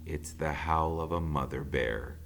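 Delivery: plain reading voice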